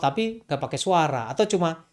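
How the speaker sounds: normal talking voice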